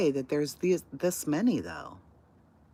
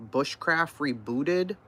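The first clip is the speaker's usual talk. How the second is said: usual speaking voice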